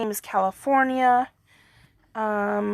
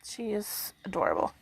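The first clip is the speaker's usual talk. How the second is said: whisper; 10 dB quieter than usual. Real, not acted. Whisper about dog.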